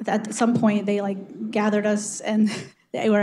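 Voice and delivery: usual speaking voice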